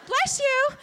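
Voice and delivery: a hint of a sweet helpful supervisor; preceptor's bless you. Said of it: "She goes".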